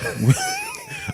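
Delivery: squealing burst of laughter; high shrieking laugh. Real, not acted.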